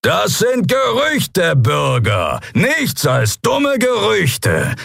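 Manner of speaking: high, slow, drawn-out shout; loud commanding bellow. A narrator's reading. Captain dismisses rumours to the citizens, bellowing. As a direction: bellow it over the din. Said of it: "Das laute Organ des Kria-Hauptmanns erhob sich".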